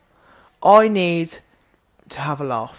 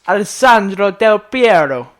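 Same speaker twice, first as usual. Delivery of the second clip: slow, louder, Italian-style "Alessandro Del Piero"; mock Italian pronunciation; 3 semitones higher, 7 dB louder, slower than usual. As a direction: ham up a pundit's Italian accent. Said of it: "pronounced, like, an Italian name".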